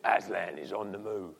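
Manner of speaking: low Cockney beaver voice. Retold or imitated. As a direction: drop into a Cockney accent like the film's beaver. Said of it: "it's the Cockney Beaver".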